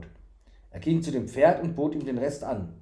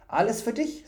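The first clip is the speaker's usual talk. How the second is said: high, proud offering; 8 semitones higher than usual. A narrator's reading. Fool offering stolen food to Thury, proud. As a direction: present it proudly like a treasure. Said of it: "sagte der Narr stolz".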